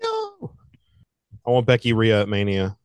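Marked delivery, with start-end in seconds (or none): 0.0-0.5 tiny high-pitched squeaky no; mock protest. Real, not acted. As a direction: squeal it softly in falsetto. Mock protest at Bianca winning.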